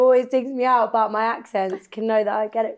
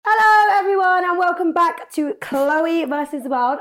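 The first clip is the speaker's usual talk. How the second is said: host greeting; 5 semitones higher than usual. Real, not acted. Host welcoming listeners back to the show, excited shout. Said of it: "podcast host intro".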